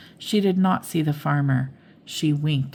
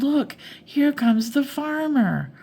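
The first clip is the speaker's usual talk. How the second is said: high, slower urgent warning; alarmed yell; 10 semitones higher than usual. A narrator's reading. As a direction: warn her the farmer is coming. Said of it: "Minnie yelled".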